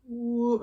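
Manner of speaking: sharp high, loud whoop; a quick comic whoop. Retold or imitated. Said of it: "He's like".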